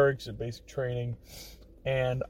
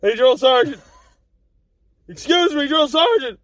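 from a polite call to a loud high shout; calling out, building; 8 semitones higher, 7 dB louder than usual. Retold or imitated. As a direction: try harder and louder to get the sergeant's attention. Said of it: "I'm like".

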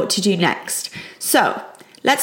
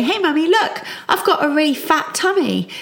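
put-on playful, higher-pitched child's call; jovial child; 6 semitones higher than usual. Child telling Mummy about their tummy, jovial and high. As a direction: show Mummy something with cheerful, light glee. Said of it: "they say it in a jovial tone of voice".

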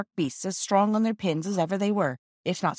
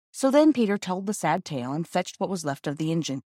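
ordinary delivery

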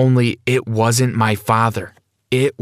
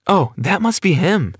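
high-pitched, slightly nervous flutter; a touch of nerves, voice lifted high; 8 semitones higher than usual. Acted. Mom hears the doorbell ring, mildly nervous. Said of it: "She sounded so nervous".

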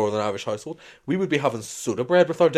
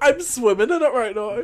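laughing; 11 semitones higher, 4 dB louder than usual. Real, not acted. Joking about spit.

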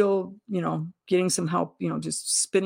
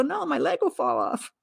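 alarmed; 8 semitones higher than usual. Retold or imitated. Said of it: "And I go".

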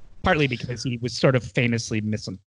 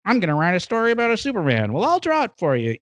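fast, higher eager geek voice; nerd voice; 5 semitones higher, faster than usual. Retold or imitated. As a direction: rattle it off like an excited comic-book nerd. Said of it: "Those are great classic nerd voice".

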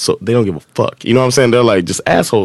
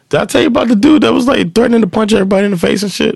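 excited; 11 semitones higher, faster than usual. Real, not acted. Sudden excited aside.

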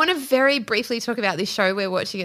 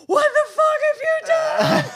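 high, loud, slow imitation of a furious yell, laughing; mock furious shout; 4 dB louder, slower than usual. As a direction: shout it in hammed-up horror at the partner. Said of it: "you'd looked at it and just were like".

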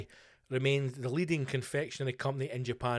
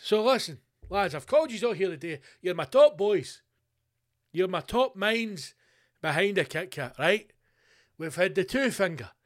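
loud pep talk to the team; rally your top boys, raised voice; fired-up executive boss; 3 semitones higher, 5 dB louder than usual. Acted. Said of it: "executives in a room going".

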